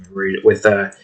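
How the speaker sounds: usual speaking voice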